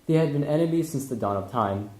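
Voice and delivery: ordinary talk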